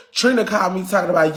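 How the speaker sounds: usual speaking voice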